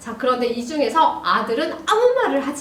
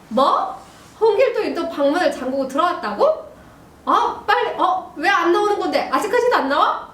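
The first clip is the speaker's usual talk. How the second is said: higher, indignant questioning; role-played dad; 5 semitones higher than usual. Dad learns son locked himself in, acted indignant questions. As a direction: act a father hearing bad news about his son. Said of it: "아빠".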